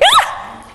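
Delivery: high, sudden dog screech; fearful screech. Shown as a demonstration. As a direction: imitate a frightened dog's high yelp. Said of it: "they screech".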